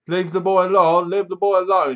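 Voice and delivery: imitating a forceful demand; acted command. Acts intruder's command with shotgun.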